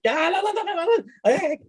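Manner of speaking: high, hurried no-no-no; high flustered denial; flustered protest. Retold or imitated. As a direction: wave her off in a panic. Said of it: "and I'm like".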